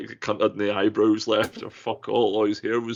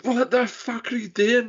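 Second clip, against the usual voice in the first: mock alarmed yell; 13 semitones higher than usual. Shocked at coworker's fire.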